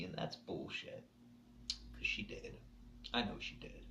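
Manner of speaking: hushed, grief-choked insistence; choked whisper. Real, not acted. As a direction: hold on to it through a whisper. Insisting she loved him.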